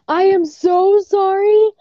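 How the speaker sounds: high, drawn-out panicked sorry; retelling a panicked apology, pitch jumping up; frantic apology. Retold or imitated. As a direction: gush the apology in a rush of panic. Panicked apology retold to boyfriend.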